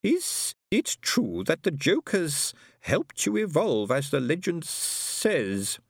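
high, very slow, stretched-out character voice; inquisitive Herr Schugen figure. A narrator's reading. Herr Schugen asking the bank about a legend, inquisitively. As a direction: draw the question out high and slow.

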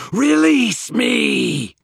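high, drawn-out loud shriek; monster's scream. A narrator's reading. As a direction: shriek to be set free. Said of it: "the thing screamed".

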